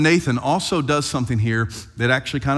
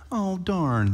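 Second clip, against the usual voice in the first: understated, half-hearted show of anger; mild mock annoyance; 6 semitones higher than usual. Preacher imagining David's too-mild anger, mock annoyance. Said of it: "when you think anger greatly kindled".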